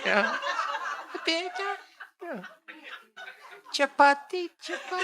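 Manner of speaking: very high, thin laughing plea; high beggar voice asking for chapati; old priest begging. Acted. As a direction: whine for bread like a frail beggar. Disguised priest begging food.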